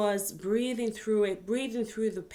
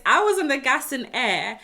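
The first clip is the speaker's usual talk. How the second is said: usual speaking voice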